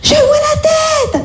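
very high, drawn-out mock cheering; hammed-up midwife sing-out; imitating excited midwives. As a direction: stretch each call out in a high midwife voice. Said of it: "Elles m'ont fait, euh".

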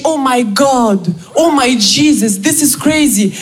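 slow, gushing oh my God; lightly voiced American friend overdoing the praise. Friend in the US hears about the Olympia, mild gushing. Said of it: "Elle me dit".

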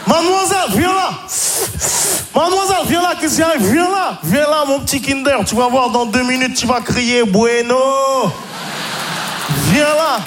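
high, shouted catcalls, hammed up; pushy catcaller. Creep accosting a woman at the station, pushy high catcalling. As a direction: call out insistently like a pushy street pest. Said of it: "des gros relous qui vous abordent".

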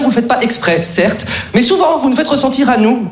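ordinary talk, nothing marked

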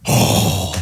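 souffle outré, sans voix; « oh » indigné imité. Retold or imitated. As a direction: imiter deux Parisiens qui se cognent, « oh » soufflé. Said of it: "ils ont fait". (exclamation soufflée de Parisien offusqué)

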